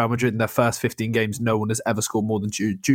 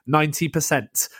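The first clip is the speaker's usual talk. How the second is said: ordinary delivery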